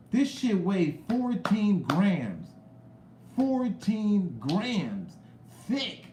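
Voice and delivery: high, slow, drawn-out marveling; amazed emphasis. Real, not acted. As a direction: savor the weight in each word. Marveling at a heavy chain.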